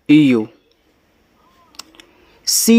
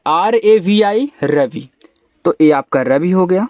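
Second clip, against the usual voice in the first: ordinary talk, nothing marked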